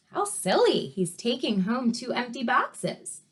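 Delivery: ordinary delivery, nothing marked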